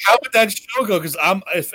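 ordinary talk

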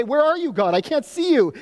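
high, pleading call upward; mock anguished plea; desperate cry to God. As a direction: call out lost and searching. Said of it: "And then we say".

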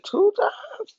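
very high, incredulous squeak; shocked question. Retold or imitated. Husband hearing about the two shots, squeaking in disbelief. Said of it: "I said".

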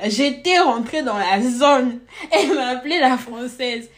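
loud words shaking with laughter; laughing. Real, not acted. Retelling the Française nickname to a co-host with laughter. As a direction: laugh through the hurt.

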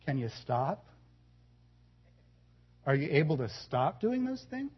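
soft, low and quiet question; hushed calm challenge. Retold or imitated. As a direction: ask it almost under your breath, calmly cornering him. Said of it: "said very quietly".

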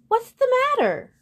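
very high, loud squawky question; eagle's voice. A narrator's reading. Eagle just woken by the unicorn, high-voiced alarm. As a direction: pitch it way up as a startled bird.

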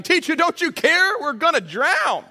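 high, loud cry for help over the storm; frightened disciples shouting at their sleeping teacher; panicked shout. Retold or imitated. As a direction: yell it like you're about to drown. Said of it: "woke him up shouting".

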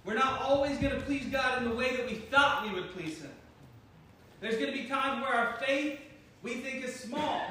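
ordinary talk

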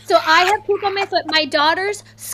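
ordinary delivery

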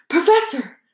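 high urgent call of alarm; boy crying out in panic. Acted. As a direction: blurt it out, startled. Harry cries out in shock.

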